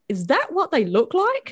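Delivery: incredulous reaction. Acted. Fan reacting on meeting the voice behind the game, incredulous. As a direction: pitch up in acted disbelief.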